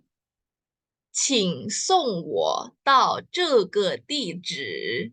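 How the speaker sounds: "请送我到这个地址" said very slowly, word by word; slow Mandarin. Shown as a demonstration. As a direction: teach the full sentence slowly. (pelafalan Mandarin sangat pelan)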